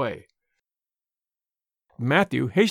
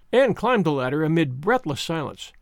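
plain reading voice

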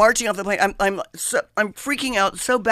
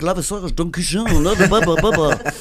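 French stewardess gibberish, laughing at the end; mock French accent; 3 semitones higher, 4 dB louder than usual. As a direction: parody a snooty French stewardess. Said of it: "you know, she's French".

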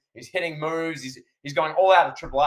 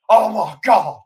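loud, higher mock commentator scream; Jim Ross; 5 semitones higher, 8 dB louder, slower than usual. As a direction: cry out like an appalled announcer. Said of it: "Jim Ross screaming".

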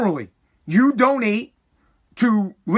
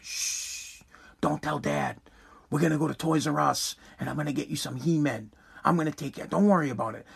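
much softer, hushed and sweet; sweet secretive mom; 6 semitones lower, 9 dB quieter than usual. Retold or imitated. Mom promising her kid toys behind dad's back, hushed and sweet. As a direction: soothe the kid in a conspiratorial hush. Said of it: "She comes in real sweet".

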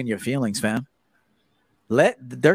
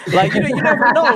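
laughing; 9 semitones higher, 8 dB louder than usual. Real, not acted. Laughing at his own joke.

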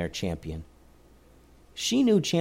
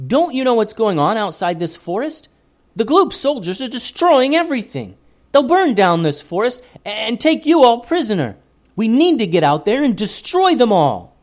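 loud, higher heated rant; angry boy; 11 semitones higher, 7 dB louder than usual. A narrator's reading. Joseph warning the forest people, loud and angry. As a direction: rage against the enemy soldiers.

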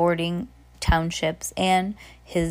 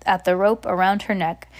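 ordinary delivery

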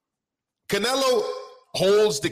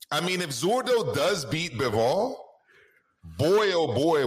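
ordinary delivery

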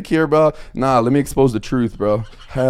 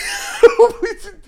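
high burst of laughter; 6 dB louder than usual. Real, not acted.

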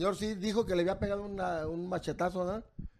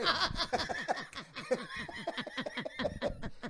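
long, high-pitched laughing fit; real breathless laughing fit, higher than speech; helpless laughter; 7 semitones higher, 7 dB quieter than usual. Real, not acted. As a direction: crack up and keep laughing. Cracks up telling the story.

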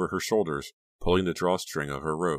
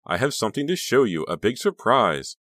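high, loud, gleeful announcement; eager build, drawing out the ending; excited exclaiming; 6 semitones higher, 5 dB louder than usual. A narrator's reading. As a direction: tease the big news with excitement. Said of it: "Honey exclaimed".

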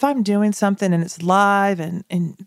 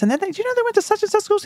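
fast, much higher gushing rush of gossip; breathless excitement, words tumbling out; excited chatter; much faster than usual. Retold or imitated. Telling husband about store stranger, high and fast.

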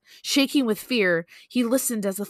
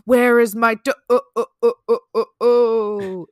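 hammed-up ghostly groan; 2 semitones higher, 8 dB louder than usual. A narrator's reading. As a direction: moan it out, breaking the last vowel into pulses. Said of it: "it groaned".

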